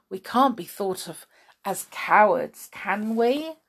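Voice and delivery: plain reading voice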